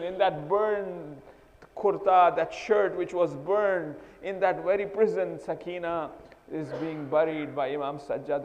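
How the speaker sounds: full-force grieving sermon; impassioned preaching. Acted. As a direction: drive every phrase home in grief.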